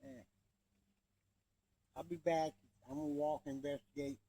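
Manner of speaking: distant from the mic; calling from far away. Real, not acted. Speaker walks away from camera.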